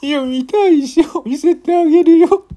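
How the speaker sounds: put-on very high, loud drunk woman; dead drunk woman. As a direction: shriek it, wasted and pushy. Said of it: "一人の泥酔した女性が".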